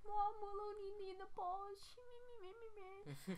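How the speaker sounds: high, mocking whine; whiny tattling brother. Brother calling mom to tattle, high mock whine. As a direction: imitate a tattletale, high and babbling into nonsense.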